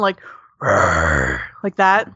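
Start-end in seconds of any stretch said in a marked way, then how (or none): 0.6-1.4 very low guttural growl; guttural bison growl. Shown as a demonstration. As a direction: growl it from deep in the throat. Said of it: "a guttural sound".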